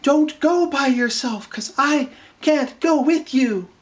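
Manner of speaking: mock Garnet. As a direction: drawl out the awkward line. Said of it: "Then Garnet's like".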